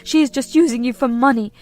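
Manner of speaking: high, loud venomous mother; furious demand. Acted. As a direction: spit out the ultimatum.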